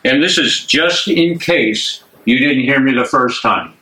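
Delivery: ordinary talk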